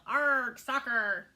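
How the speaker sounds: loud pirate cry; pirate yell. A narrator's reading. Captain kicks the ball, loud pirate yell. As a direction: bellow it as you kick. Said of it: "kicked the ball and yelled".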